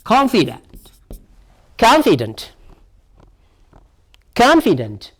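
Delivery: "Confident" said alone with a falling tune; "Confident" as a careful pronunciation drill; stressed-syllable demo. Shown as a demonstration. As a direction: model the stress in "Confident" for learners. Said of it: "Where does the stress lie on here now?".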